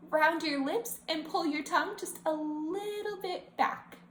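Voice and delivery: ordinary talk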